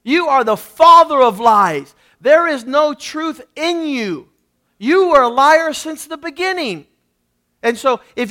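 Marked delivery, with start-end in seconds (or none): 0.0-6.9 loud, forceful denunciation of the devil; spiritually strong rebuke. Acted. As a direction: preach it hard, weighting every word. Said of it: "be spiritually strong and say".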